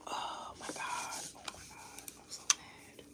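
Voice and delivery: frustrated whisper under the breath; hushed, exasperated oh my God. Real, not acted. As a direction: whisper your anger to yourself. Frustrated at the freezing computer.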